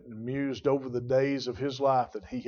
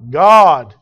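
one word shouted much louder; preacher's climactic cry; shouted God; 2 semitones higher, 17 dB louder than usual. Real, not acted. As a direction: belt out God at full force.

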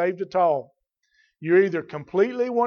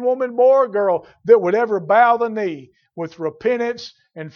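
raised, insistent pulpit delivery; forceful preaching; 4 semitones higher, 5 dB louder than usual. Real, not acted. Preacher promising salvation to whoever will come, forceful preaching. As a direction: proclaim it to the whole congregation.

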